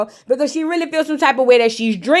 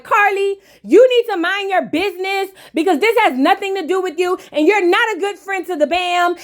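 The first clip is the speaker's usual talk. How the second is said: higher, heated mock tirade; Jessica going off; 5 semitones higher than usual. Jessica standing up to tell Carly off, higher and heated. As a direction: let her rip at Carly in a raised voice. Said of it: "gets out her seat and was like".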